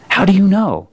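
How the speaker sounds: put-on a puzzled boy pushing back; young Shasta.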